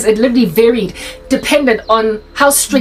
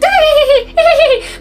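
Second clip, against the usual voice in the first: high-pitched giggle; mock giggle. Pupils giggling behind the teacher's back, high giggle.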